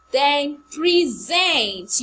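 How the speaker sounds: "present" very slow and loud, pri-ZENT; verb stress. Shown as a demonstration. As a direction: stretch "present" out, weight on the last syllable. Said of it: "you present a present".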